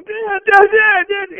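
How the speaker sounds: put-on high, loud child calling Dad over and over; a little boy yelling for his dad. Little boy interrupting his father on the phone, shrill calling. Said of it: "and he's going".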